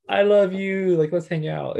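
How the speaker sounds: mock gushing. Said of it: "you're just kinda like".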